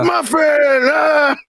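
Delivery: McMuffin shout. Retold or imitated. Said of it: "McMuffin vibes".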